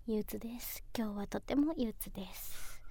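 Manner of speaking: whispered, slow and gloomy. Real, not acted. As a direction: sigh it out in a whisper.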